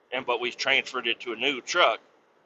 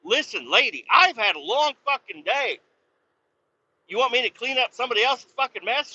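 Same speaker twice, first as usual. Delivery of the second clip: high, exasperated complaint; tired after a long day, snapping back at her; fed-up retort; 10 semitones higher than usual. Retold or imitated. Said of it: "I'm like".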